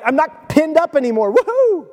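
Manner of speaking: high-pitched gleeful cheer; playful whoop climbing way up high; excited dog. Acted. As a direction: voice an overjoyed dog set loose. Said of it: "His dog is just so excited".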